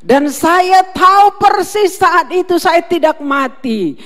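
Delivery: loud, ringing sermon climax; full-force preaching. Acted. Pastor proclaiming healing through prayer to the congregation, shouting. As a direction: preach it out to the whole church.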